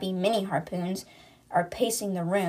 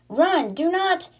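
high-pitched, louder mock yell; imitated shout, pitched way up; retold yelling; 9 semitones higher, 4 dB louder, slower than usual. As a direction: yell an urgent warning, held a little in check. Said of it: "Luca's yelling".

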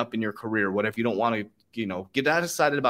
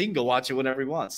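ordinary delivery

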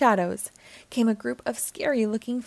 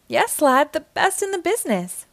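louder, higher proud boast; proudly; 7 semitones higher, 4 dB louder than usual. Acted. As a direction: puff up with pride. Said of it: "replied Mister Krabs proudly".

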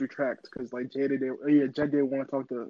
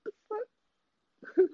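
startled high squeak. Retold or imitated. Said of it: "You be like".

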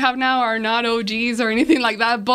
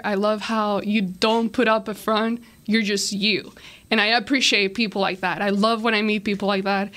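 ordinary talk

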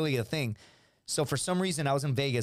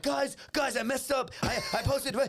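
high, urgent plea; acted-out frantic admission; retold panic; 9 semitones higher than usual. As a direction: blurt a panicked confession. Confesses in panic to cousins.

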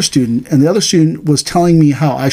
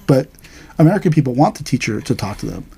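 usual speaking voice

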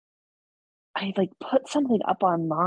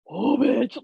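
higher, sassy "oh, bitch"; scornful retort; 7 semitones higher, 3 dB louder than usual. Retold or imitated. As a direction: toss it off with attitude. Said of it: "And I was like".

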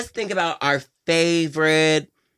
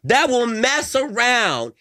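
drawn-out shout; 8 semitones higher, much slower than usual. Real, not acted. Heated rant about other girls.

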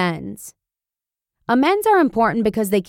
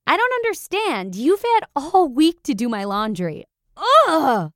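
put-on higher, lightly whiny teen voice; whiny son; 6 semitones higher than usual. Son complaining to mom about his laundry, mild mock whine. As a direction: imitate a sulky kid complaining. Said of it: "he yells back".

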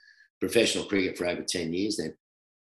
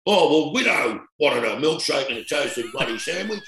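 half-hearted impression of a big-eating mate justifying himself; a hint of mock innocence, speeding up at the end; Merv Hughes; 6 semitones higher than usual. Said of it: "he says".

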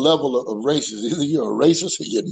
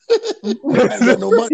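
high, laughing through it; 9 semitones higher, 4 dB louder than usual. Real, not acted. Laughing at his own joke to the girls, high. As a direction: crack up at your own punchline.

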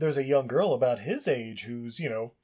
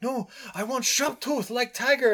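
much higher, put-on child voice; mock boy in broken English; child pleading with his mom; boy insisting; 11 semitones higher than usual.

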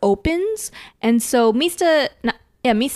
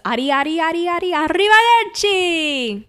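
catchphrase battle cry; 8 semitones higher than usual. Retold or imitated. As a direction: build the chant up to the finishing blow. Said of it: "And he goes".

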